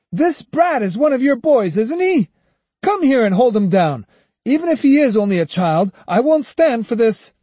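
high, loud furious demands; angry. A narrator's reading. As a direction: rage at the manager. Kojiro enraged at the boy.

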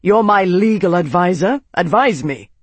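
loud, hard demand from a senator. A narrator's reading. Arnas to his legal advisor, loud angry demand. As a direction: bark the order at him. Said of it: "Arnas demanded".

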